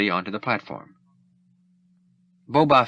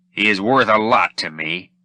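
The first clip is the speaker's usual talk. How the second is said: bounty hunter; 5 dB louder than usual. Acted. Boba Fett voiced in story.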